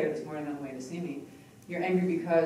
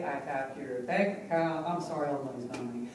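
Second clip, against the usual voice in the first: usual speaking voice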